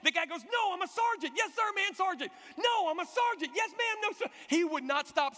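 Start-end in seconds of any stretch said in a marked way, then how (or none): 0.4-1.3 high, exasperated mock yell; hammed-up indignant correction; yelling sergeant. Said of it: "The guy's yelling at him". 1.4-2.3 high, hurried mock-panic reply; flustered military response, pitched up; panicked recruit. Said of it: "He was panicked". 2.6-3.5 high, exasperated mock yell; hammed-up indignant correction; yelling sergeant. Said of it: "The guy's yelling at him". 3.5-4.3 high, hurried mock-panic reply; flustered military response, pitched up; panicked recruit. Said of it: "He was panicked".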